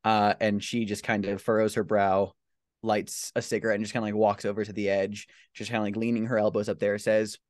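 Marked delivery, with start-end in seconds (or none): none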